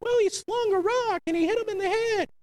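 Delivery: mock someone who reads it as a simple tale; high, sing-song parody of a shallow reading; mocking casual Bible reader. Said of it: "We read this as a Bible story".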